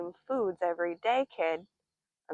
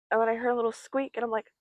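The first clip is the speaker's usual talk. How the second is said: ordinary talk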